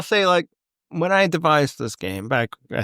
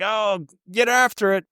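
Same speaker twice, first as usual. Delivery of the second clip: imitating hockey bro; 8 semitones higher than usual. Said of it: "And it's like".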